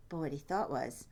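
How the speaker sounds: plain reading voice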